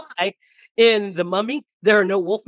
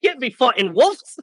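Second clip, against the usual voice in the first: mock Aussie refusal; 8 semitones higher, 5 dB louder than usual. Imitating Aussie actor.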